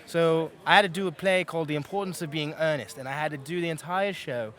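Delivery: steady British accent held on request. Shown as a demonstration. Voice actor shows the interviewer his British accent. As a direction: keep the British accent going, easy and casual. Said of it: "So now I can switch back to the New York one".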